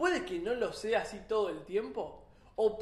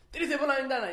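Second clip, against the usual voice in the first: loud command to jump; barked order; 4 semitones higher, 5 dB louder, faster than usual. Acted. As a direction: order him out the window, kingly. French king orders soldier. (el rey francés ordena al soldado, con voz de mando)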